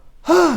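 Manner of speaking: high, loud gasp; exaggerated winded gasp; gasp for air. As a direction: gasp as if the chores left no breath. Gasps after frantic list of chores.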